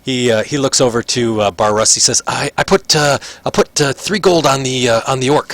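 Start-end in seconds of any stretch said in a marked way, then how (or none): 2.3-5.5 high, excited betting voice; rushing to lay a bet on the fight; eager gambler. Acted. Prisoner placing bets on the orc fight, excited and high.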